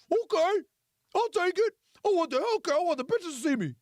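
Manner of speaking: high, loud goofy sucker voice; silly buyer voice. Retold or imitated. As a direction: play the eager fool jumping at the deal. Gullible buyer agrees to deal.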